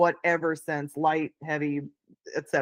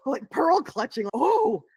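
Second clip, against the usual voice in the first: mock shock; 10 semitones higher, 5 dB louder, slower than usual. Mock shock at recount request.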